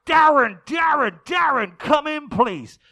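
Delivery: loud, high radio hailing; mock dispatcher trying hard to get through; urgent walkie-talkie voice. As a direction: shout insistently into a two-way radio. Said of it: "and again it goes".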